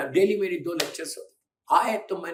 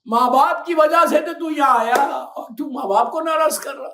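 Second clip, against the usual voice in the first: loud, high rebuke; a stern Imam's shouted rebuke; scolding Imam; 8 semitones higher, 8 dB louder than usual. Retold or imitated. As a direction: scold the disobedient child. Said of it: "मौला बोलेंगे".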